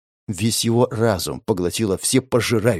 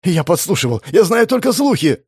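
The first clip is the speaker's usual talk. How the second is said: high-pitched, hurried-sounding plea; frightened confession; 6 semitones higher than usual. Acted. Tearful captive confessing to his torturer, frightened. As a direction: blurt it out in fear.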